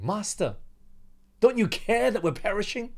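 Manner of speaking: desperate disciples pleading to their master; desperate cry. Acted. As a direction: cry out as men about to drown.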